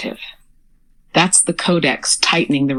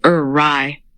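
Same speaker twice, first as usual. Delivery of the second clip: usual speaking voice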